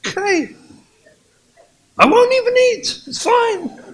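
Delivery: high, loud passive-aggressive reply; sulky martyr. Acted. Wife snapping back that she won't even eat, martyred. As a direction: play the martyr, stung.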